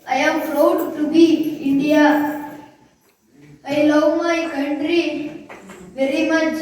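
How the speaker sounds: slow, loud, word-by-word recitation; recited speech. Real, not acted. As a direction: deliver each word slowly and proudly to the crowd. Child recites Independence Day speech.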